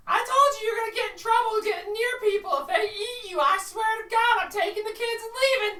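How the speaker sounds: high, loud nagging rant; squawking wife threatens to leave; scolding bird wife. Acted. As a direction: chew him out like a furious wife.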